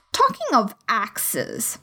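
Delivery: slow, ominous grand lady; menacing duchess. A narrator's reading. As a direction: drawl it with a threat. Duchess voiced.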